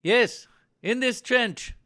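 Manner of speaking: loud, high, drawn-out shout; calling out. Acted. Trapped soldier answers a rescuer, shouting back.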